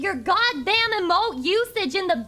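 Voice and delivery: loud, high-pitched rant at chat; slow, emphatic angry shout; exasperated scolding. Real, not acted. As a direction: yell at them for spamming. Scolding chat for emote spam.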